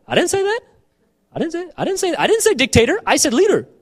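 high-pitched, incredulous correction; acted bafflement at being misheard; exasperated protest.

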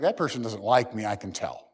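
soft, low, dejected murmur; quiet inner thought. Retold or imitated. Preacher voices someone sensing dislike, low and quiet. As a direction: think it to yourself, hurt.

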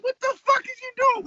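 high-pitched frantic demand; retold squawk of disbelief; frantic exclamation. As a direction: act out yelling at a friend mid-escape. Retelling yelling at friend to hurry.

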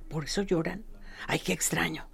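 hushed, soft scornful aside; scornful whisper. Retold or imitated. Passersby whisper about the grieving family, disdainfully. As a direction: murmur it to each other, baffled and disdainful.

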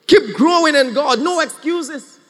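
loud fired-up call; preaching shout. Real, not acted. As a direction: call it out with fervor. Preacher exhorting congregation.

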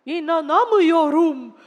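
loud, slow, drawn-out disbelief; amazed. Acted. Father amazed by the dog's success, disbelieving. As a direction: exclaim it in astonishment.

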